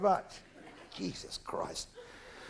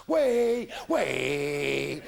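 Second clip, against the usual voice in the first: loud, drawn-out whoop; acted whooping cry; 2 semitones lower, 5 dB louder than usual. Comic acting out grandad in the shed, whooping loudly.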